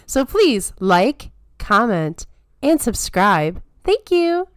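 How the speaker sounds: high, sweet princess voice; theme park princess. Pretend. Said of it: "besides the princess voice I can do".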